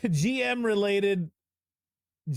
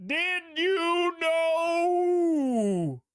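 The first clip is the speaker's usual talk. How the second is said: drawn-out announcer; 11 semitones higher, much slower than usual. Acted. Hyped fun facts intro.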